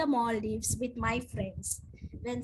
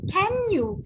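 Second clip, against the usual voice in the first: polite ask; 6 semitones higher, 5 dB louder than usual. Retold or imitated. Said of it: "You can be polite and ask".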